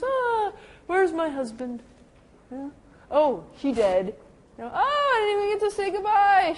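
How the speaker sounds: put-on high, loud, grieving old woman voice; distressed patient. Confused woman asking her caregiver for her husband, high and upset. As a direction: cry out for a husband who is gone.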